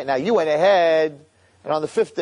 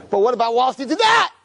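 higher, louder, insisting demand; frustrated shout; 8 semitones higher, 4 dB louder than usual. Acted. Accuser yelling at the court for missing evidence. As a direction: push back hard and indignant. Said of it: "And then he's like".

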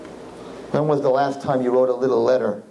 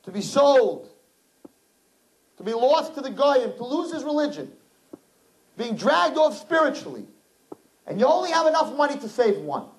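impassioned preaching; 12 semitones higher than usual. Acted. Rabbi telling the scary Zohar to the girls, high and urgent. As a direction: paint the dying man with urgency.

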